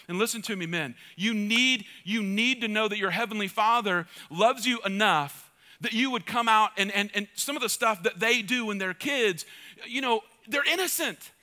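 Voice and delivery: normal talking voice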